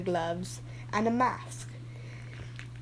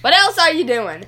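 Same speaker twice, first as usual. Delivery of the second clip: angry; 6 semitones higher, 10 dB louder than usual. Acted. Demanding answer loudly.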